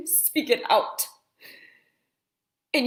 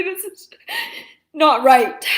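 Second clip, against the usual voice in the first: tearful, bursting loud on not; crying out in protest; 3 semitones higher, 9 dB louder than usual. Real, not acted. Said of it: "it really bothers me sometimes when I can't stop crying".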